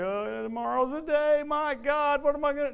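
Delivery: high, loud, slow fretting whine; mock gloomy groom. Preacher mocking a sad groom, high whiny fretting. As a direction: ham up a nervous bride-to-be dreading the day.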